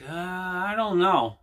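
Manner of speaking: drawn-out, mock-reluctant hesitation; hesitant haggler. Buyer haggling at a yard sale, retold as hesitant. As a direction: stretch it out as if unsure.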